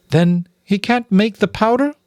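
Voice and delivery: high, bewildered mother mouse; Mrs Frisby puzzled. Acted. Mother mouse to the rats, high and bewildered. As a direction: sound lost, pleading to understand.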